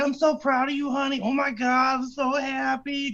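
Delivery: high, gushing grandma voice, slowing at the end; cute old lady. Retold or imitated. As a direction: play a doting grandma gushing. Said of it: "cute old lady shit".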